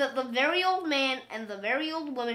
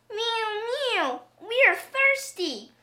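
high, slow meowing voice; drawn-out, high kitty plea; crying cats; 9 semitones higher, 3 dB louder, slower than usual. A narrator's reading. Thirsty cats cry to the old man, high slow meowing. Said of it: "cried the hundreds of cats".

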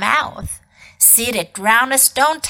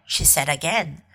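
ordinary delivery, nothing marked

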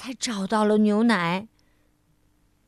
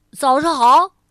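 hearty greeting; 3 semitones higher, 11 dB louder than usual. Acted. Butcher eagerly greets customer.

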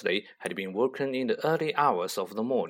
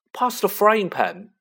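put-on bossy boy; 8 semitones higher, 5 dB louder than usual. Dudley orders Harry.

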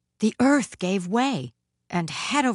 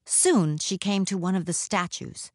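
usual speaking voice

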